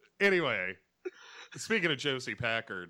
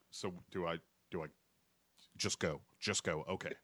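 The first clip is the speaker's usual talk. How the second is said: low, hesitant mock uncertainty; halting, lower voice of an actor awaiting his cue; unsure Mountie; 5 semitones lower, 5 dB quieter, faster than usual. Voicing the Mountie looking around for his cue, low and hesitant. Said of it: "he just kinda looks around like".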